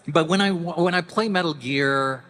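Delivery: usual speaking voice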